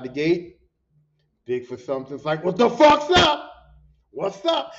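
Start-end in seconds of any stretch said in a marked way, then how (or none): 2.4-4.7 loud, slow challenge; confrontational challenge. Retold or imitated. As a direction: square up and call him out. Confrontation outside the gate.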